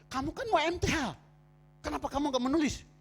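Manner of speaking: protes bernada tinggi dalam kutipan; teguran yang diperagakan. Retold or imitated. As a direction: tegur dengan nada melonjak, seakan tak percaya. Menegur lawan bicara dalam kutipan. (menegur dengan nada tinggi)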